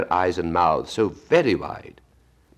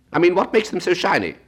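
a high, loud squawking Gryphon explaining; 14 semitones higher, 5 dB louder than usual. Acted. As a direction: pitch it high and bold as the Gryphon.

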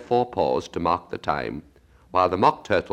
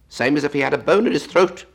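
higher, louder, quick Gryphon voice; lively Gryphon; 6 semitones higher, 5 dB louder, faster than usual. A narrator's reading. Gryphon remarks on the sobbing Mock Turtle, brisk and loud. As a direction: blurt it out briskly, pitched up.

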